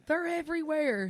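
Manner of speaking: high, loud mock panic; freaking out. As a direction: imitate a husband's panicked cry. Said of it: "freaking out".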